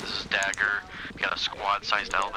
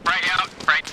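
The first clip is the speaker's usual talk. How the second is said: cover me shout; 10 semitones higher than usual. Real, not acted. Grenade callout in combat.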